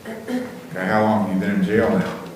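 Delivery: normal talking voice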